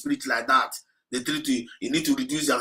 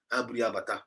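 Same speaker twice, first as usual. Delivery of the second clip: ordinary talk, nothing marked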